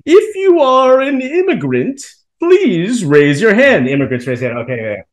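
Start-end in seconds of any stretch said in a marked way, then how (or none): 0.0-3.9 slow, higher, monotone mock speech voice; robotic college president. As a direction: drawl it out like a robot announcer. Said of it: "he goes".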